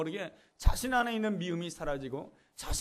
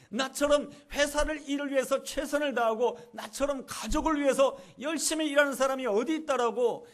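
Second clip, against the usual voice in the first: higher and louder, indignant protest; angry retort; 7 semitones higher, 4 dB louder than usual. Retold or imitated. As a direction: argue back hotly with a raised voice. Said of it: "화를 내면서 이렇게 말합니다". (화내며 반박하는 목소리, 높고 크게)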